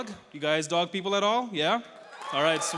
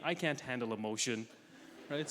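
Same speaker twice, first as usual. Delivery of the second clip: ordinary talk, nothing marked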